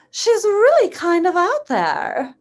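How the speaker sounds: character voice. Shown as a demonstration. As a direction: play her as a bit out there. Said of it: "this is one that I've been working on lately".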